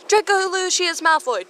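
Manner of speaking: high, loud furious yell of the full name; furious mock scolding shout; angry full-name yell. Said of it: "Pansy angrily stomping".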